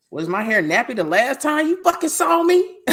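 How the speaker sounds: high, heated retold challenge; indignant retort. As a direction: fire it back, pitch climbing in disbelief.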